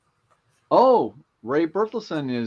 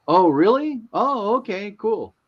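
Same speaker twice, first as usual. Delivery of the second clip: higher-pitched, mock-impressed realization; surprised youngster's reply; 8 semitones higher, 4 dB louder than usual. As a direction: imitate someone pleasantly catching on. Acting out youngster's reply to explanation.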